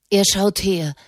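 ordinary delivery

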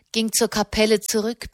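ordinary delivery

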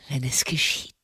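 low, soft, sinking voice; voice failing. A narrator's reading. Condemned man picturing his execution, voice failing. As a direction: let the dread take your voice down.

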